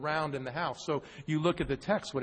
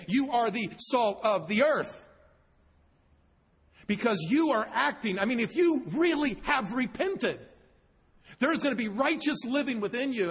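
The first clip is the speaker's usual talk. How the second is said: rising preacher fervor; 7 semitones higher than usual. Real, not acted. Preaching climax.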